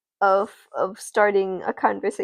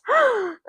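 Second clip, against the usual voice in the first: very high mock gasp; theatrical squeaky intake of breath; student's hammed-up gasp; shocked gasp; 13 semitones higher, 4 dB louder than usual. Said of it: "she gasps".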